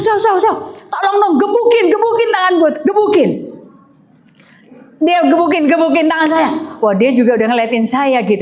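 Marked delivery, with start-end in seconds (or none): 0.0-3.3 high, laughing plea to the sister-in-law; retold urgent plea. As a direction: beg her half-laughing to beat your arm. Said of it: "Saya bilang".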